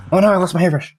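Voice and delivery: squeaky cartoon cry over a lost hairbrush; Veggie Tales voice. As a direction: play the panicked cartoon character. Veggie Tales quote imitated.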